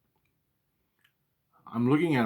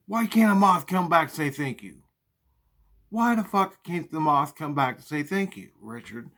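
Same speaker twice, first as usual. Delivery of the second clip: mom yelling back; 5 semitones higher, 4 dB louder than usual. Retold or imitated.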